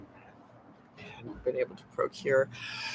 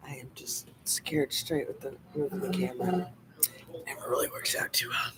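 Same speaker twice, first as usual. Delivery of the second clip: whispering; 8 dB quieter than usual. Real, not acted. Whispered while moving camera.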